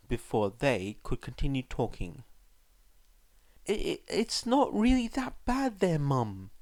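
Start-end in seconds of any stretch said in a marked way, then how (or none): 3.7-6.5 put-on higher stammering boy's voice, anxious; a flustered son trying to soothe his mum; nervous reassurance. A narrator's reading. Son reassuring his worried mother, nervous and high. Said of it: "Izuku tried to reassure her".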